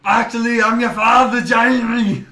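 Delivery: high, booming Arnold-style father; mock-dramatic Austrian dad; Austrian movie dad. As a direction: reveal it in a hammy Austrian voice. Acts Austrian dad revealing himself.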